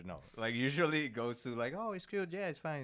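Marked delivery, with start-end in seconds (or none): 1.8-2.8 higher-pitched mock audience reply; imitating a placating listener up high; imitated polite reassurance. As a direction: lift the voice to placate, as the crowd would. Imitating crowd's polite reply about his accent.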